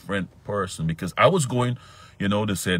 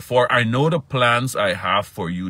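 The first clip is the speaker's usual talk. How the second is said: preaching; 7 semitones higher, 9 dB louder than usual. Acted. Preaches the verse.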